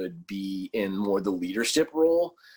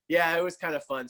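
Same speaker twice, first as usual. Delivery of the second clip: ordinary talk, nothing marked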